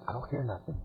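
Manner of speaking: very soft, low near-whisper; hushed. Acted. Boy to his friend in the silent woods, hushed. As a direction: drop right down as if listening hard. Boys straining to hear the camp.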